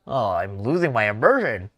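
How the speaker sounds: loud self-interruption; exasperated outburst. Real, not acted. Streamer breaking character mid-game, loud exclamation.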